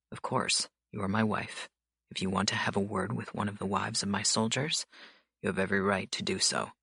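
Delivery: cool authority, unhurried; stern mafia boss. A narrator's reading. Dante voiced by narrator.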